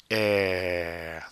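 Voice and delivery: "air" held long, mouth unmoving; slow demonstration of "air" with French R; French R demo. Shown as a demonstration. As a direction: stretch "air" into a breathy French R at the back. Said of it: "The air is flowing out of your mouth".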